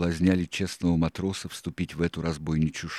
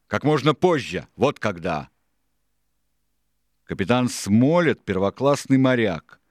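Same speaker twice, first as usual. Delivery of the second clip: loud, raised ringleader's tirade; higher, louder pirate boss holding forth; Silver, forceful and impatient; 5 semitones higher, 7 dB louder than usual. A narrator's reading. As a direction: lay out the scheme forcefully. Silver rebukes the crew.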